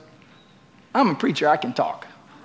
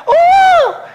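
high, loud "ooh"; mock crowd exclamation; 9 dB louder than usual. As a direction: imitate everybody's reaction with a high whoop. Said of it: "everybody's like".

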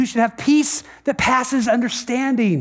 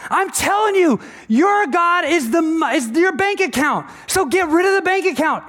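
high, insistent exasperated pleading; acted urgent exasperation, voice pushed up high; frustrated Jesus; 5 semitones higher than usual. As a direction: plead in a raised pitch, frustrated at not being heard. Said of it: "he's frustrated".

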